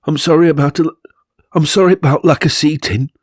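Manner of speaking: high, put-on voice of a timid hostess; apologetic Charmaine. Charmaine apologises for her bare room.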